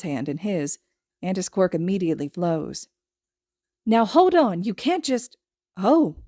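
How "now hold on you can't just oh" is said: startled. Acted. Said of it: "eyes widen".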